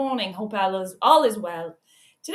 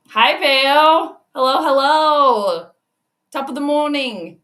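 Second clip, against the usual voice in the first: excited greeting; 3 semitones higher, 7 dB louder, slower than usual. Real, not acted. Greeting a viewer joining the stream.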